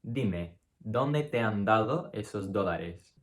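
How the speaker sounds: slow read with light dental d sounds; soft d on every word; soft Spanish d. Shown as a demonstration. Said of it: "would be pronounced".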